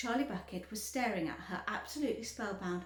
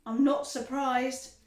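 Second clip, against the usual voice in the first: dry; 3 semitones higher, 8 dB louder, slower than usual. A narrator's reading. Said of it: "said Mister Beauregard".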